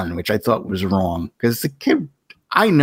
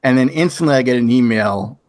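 ordinary delivery

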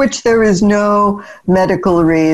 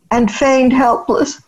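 normal talking voice

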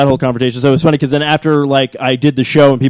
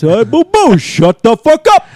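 higher, slowed cartoon bear voice; Yogi Obama; 7 semitones higher, 3 dB louder, slower than usual. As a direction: Yogi Bear gone rude, slow at the end. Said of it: "A Yogi's not gonna like this".

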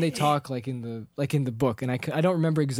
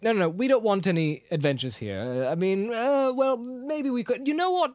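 flustered Bilbo; 7 semitones higher than usual. Retold or imitated. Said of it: "he's just like".

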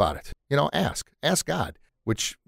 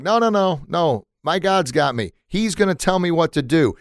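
high-pitched mock yell; acted refusal called up to a rescuer; insistent, mildly hammed-up shout back; 7 semitones higher than usual. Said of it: "the guy yells back".

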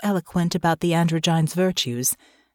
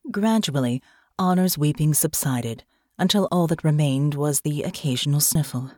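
ordinary delivery

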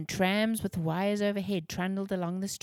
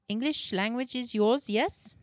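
put-on higher, asking with German accent; gentle question in a German accent; 5 semitones higher than usual. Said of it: "Herr Gutmann had a heavy German accent".

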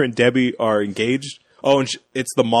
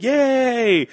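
cheering; 11 semitones higher than usual. Retold or imitated. Campers cheer a bullseye.

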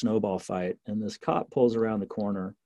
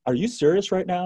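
incredulous; 9 semitones higher, 3 dB louder than usual. Retold or imitated. Flabbergasted cop.

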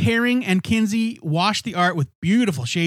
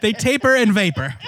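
laughing; 3 semitones higher, 5 dB louder than usual. Real, not acted. Joking wordplay with cohost.

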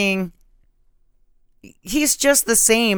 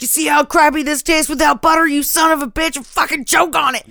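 higher, fast hammed-up yelling; mock furious rant; 9 semitones higher, 6 dB louder, faster than usual. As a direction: rant like a man shoving popcorn in his face. Acted furious rant at the sheriff.

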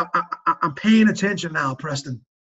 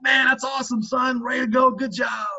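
higher, proud call of praise; cheering dad; 5 semitones higher than usual. Retold or imitated. Dad calling back to his flipping son, excited praise. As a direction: re-enact cheering on your kid.

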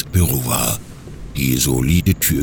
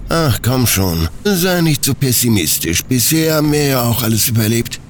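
higher-pitched character voice, lightly coaxing; Natala, coaxing; 7 semitones higher than usual. Acted. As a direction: talk a pessimistic partner round, pitched up. Natala reassures pessimistic Stanley.

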